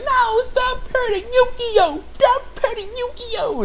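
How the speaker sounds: very high, slow put-on character squeal; mock desperate plea. Shimi begging Satan to stop hurting Yukio, hammed-up plea. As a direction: plead in an exaggerated high girlish voice.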